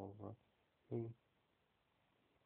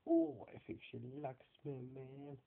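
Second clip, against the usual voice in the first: imitating Deco; 5 dB louder than usual. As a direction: ham up the cocky Mexican dog's line. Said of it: "And, like".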